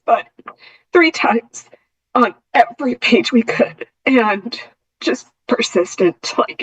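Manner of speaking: fighting back tears while telling it; intermittent tremor. Real, not acted. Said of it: "sorry, I get emotional".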